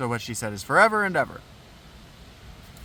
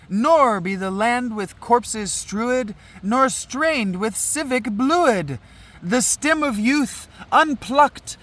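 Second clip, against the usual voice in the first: higher-pitched, ceremonial prayer recitation; declaimed verse; 11 semitones higher, 5 dB louder, slower than usual. Acted. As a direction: declaim it as a solemn choral prayer.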